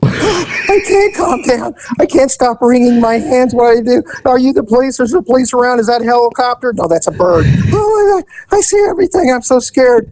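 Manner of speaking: high, jittery mock panic; nervous Nelly. Nervous customer at the car window, high jittery mock panic. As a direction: babble like a terrified first-timer. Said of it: "here's the nervous Nelly".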